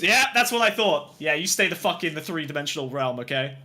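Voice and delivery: usual speaking voice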